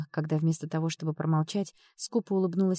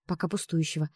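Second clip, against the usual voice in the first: ordinary delivery, nothing marked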